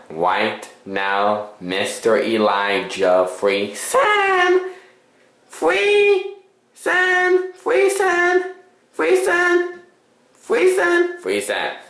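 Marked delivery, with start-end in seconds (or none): 3.7-11.2 high squeaky voice. Pretend. Repeating name in varied voices. 11.2-11.8 low quiet voice. Pretend. Repeating name in varied voices.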